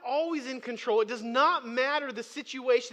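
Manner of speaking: high, loud preaching with punched key words; emphatic preaching. Real, not acted. Preacher assuring the congregation God rules, emphatic preaching. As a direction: preach it with full conviction.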